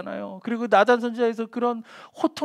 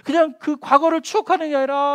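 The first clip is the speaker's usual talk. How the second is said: usual speaking voice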